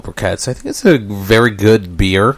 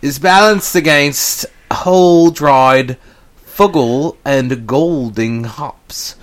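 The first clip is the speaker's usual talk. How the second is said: grand reading; 4 semitones higher than usual. Pretend. Hamming up beer label prose.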